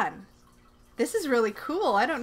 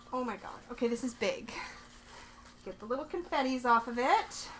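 ordinary talk

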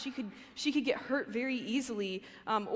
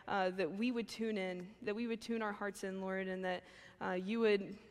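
soft, quieter prayer voice; 2 semitones lower, 4 dB quieter than usual. Acted. Speaker opening the message with prayer, soft and reverent.

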